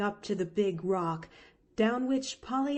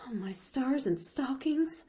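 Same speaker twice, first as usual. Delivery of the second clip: higher, understated gasp of shock; 6 semitones higher than usual. A narrator's reading. Nancy reacts to the tree climb, mildly aghast. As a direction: exclaim it with a small gasp. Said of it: "gasped Nancy".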